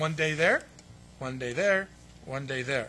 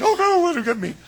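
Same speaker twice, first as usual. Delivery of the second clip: high, loud whiny laughing panic; hammed-up cowardly yelp; mock cowardice; 11 semitones higher, 12 dB louder than usual. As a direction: cringe away, offering someone else up. Mocking the cowardly disciples.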